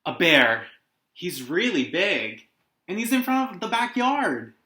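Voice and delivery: put-on excited boy. Carl insisting about the bear.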